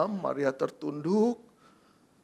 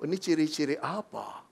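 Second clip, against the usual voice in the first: usual speaking voice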